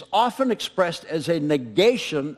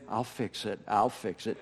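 much softer, lower, calm and kind; gentle reassurance; 8 semitones lower, 9 dB quieter than usual. Retold or imitated. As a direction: soothe a flustered coworker softly. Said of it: "very gently, very kindly saying".

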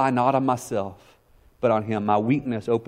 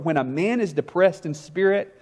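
quoting Spurgeon; 5 semitones higher than usual. A narrator's reading. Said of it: "And Spurgeon wrote".

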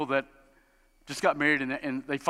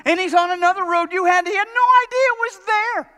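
much higher, loud incredulous cry; exasperated exclaim; 10 dB louder than usual. Real, not acted. Exasperated sermon climax.

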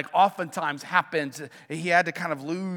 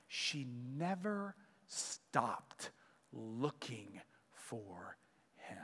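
much softer, slowing to a breathy whisper; hushed whisper; 8 semitones lower, 18 dB quieter, much slower than usual. Real, not acted. Pastor ending the mother's story for the congregation, slow hushed whisper. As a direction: let it fall to a whisper, drawn out.

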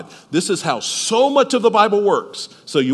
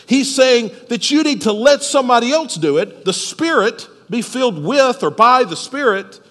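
ordinary talk, nothing marked

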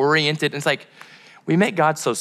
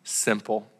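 "simple" said softly and slow, trailing down; a quiet, plain "simple"; soft, drawn-out simple; 3 semitones lower, 6 dB quieter than usual. Shown as a demonstration. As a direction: let "simple" drop low and gentle. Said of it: "when I say simple, I mean, like".

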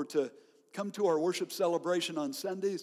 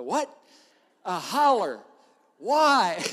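louder, rising "A holler? Why?" in mock bafflement; puzzled mom; 3 semitones higher, 9 dB louder, slower than usual. Mother asking son about the call, mock-baffled. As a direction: play the baffled mother quizzing her son.

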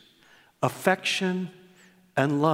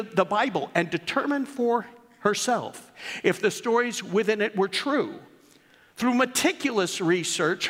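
reading aloud; 7 semitones higher than usual. Acted. Pastor reading his daughter's tribute to the church, raised storytelling read. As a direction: read it like a storyteller opening a tale.

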